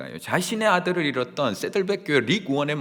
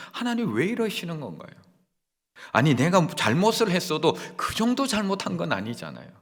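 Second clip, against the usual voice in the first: protesting to God; 6 semitones higher, 4 dB louder than usual. Retold or imitated. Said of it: "라고 항변하게 된다는 겁니다".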